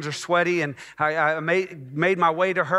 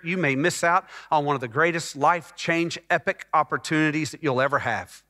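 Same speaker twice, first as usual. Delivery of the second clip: normal talking voice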